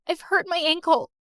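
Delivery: ordinary delivery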